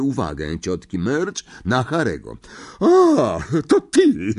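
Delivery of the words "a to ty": high, loud, scornful bark. A narrator's reading. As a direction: snap at the boy she despises. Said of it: "szczeknęła". (szczeka z pogardą)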